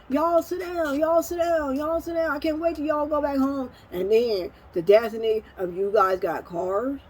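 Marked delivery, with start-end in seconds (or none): 0.1-3.7 high-pitched imitation of mom scolding grandkids; retold yelling. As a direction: mimic an exasperated grandmother's yell, pitched way up. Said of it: "she yelling at 'em".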